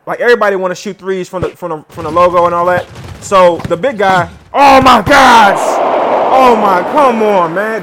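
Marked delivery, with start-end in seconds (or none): 4.5-7.8 loud, slow outcry of disbelief; real shocked shout. Real, not acted. Gamer yelling at his team's fumble, real loud dismay. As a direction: drag out the horror at the screen. Team fumbles game away.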